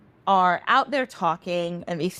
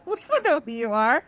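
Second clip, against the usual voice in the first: amused dismissal; 3 semitones higher than usual. Acted. Pair laughing at Eshu's boast.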